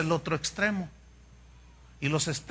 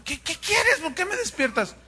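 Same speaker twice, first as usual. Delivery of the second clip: put-on much higher, irritated old man woken up; grumpy woken priest; 10 semitones higher than usual. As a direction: snap at the boy for waking you. Elí despertado, irritado. (sacerdote gruñón despertado, voz aguda)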